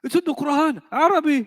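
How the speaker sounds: high, loud indignant protest; Moroccan friend protesting. Retold or imitated. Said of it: "You say".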